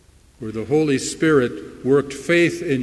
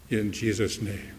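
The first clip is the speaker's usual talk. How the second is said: ordinary talk, nothing marked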